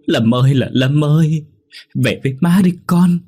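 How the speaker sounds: high, loud grieving lament; keening call to a drowned son; wailing mother. A narrator's reading. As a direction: cry out for a lost child, voice climbing. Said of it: "đấm tay bùm bụp vào ngực mà khóc".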